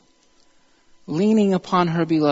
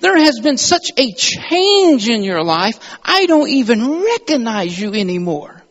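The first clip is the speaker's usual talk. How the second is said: high and loud, slowed, full of wonder; astonished; 7 semitones higher, 7 dB louder than usual. Acted. Writer marvelling at a changed life, loud and astonished. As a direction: marvel at someone you no longer recognize. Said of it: "this is what she's saying".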